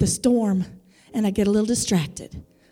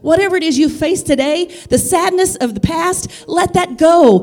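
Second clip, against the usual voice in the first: driving, emphatic sermon delivery; impassioned preaching; 5 semitones higher, 6 dB louder than usual. Real, not acted. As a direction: build the promise with fervour for the congregation. Preaches, voicing the Father's promise.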